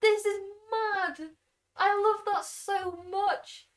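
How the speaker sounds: high, loud, drawn-out delight; thrilled amazement. Real, not acted. Streamer thanking the fan artist, thrilled.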